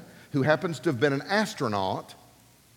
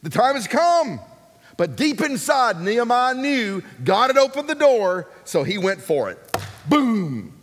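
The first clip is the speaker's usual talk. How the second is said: high, drawn-out preacher's proclamation; stretched, ringing pulpit delivery; rousing preaching; 6 semitones higher, 5 dB louder, slower than usual. A narrator's reading. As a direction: build the Bible story to a punchy climax. Preaches climax of Nehemiah story.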